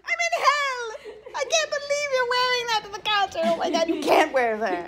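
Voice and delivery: high squealing protest, half laughing; exasperated wail. Real, not acted. Friends wearing that to the concert, exasperated wail. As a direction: wail it high, then come down. Despairs over friends' shirts.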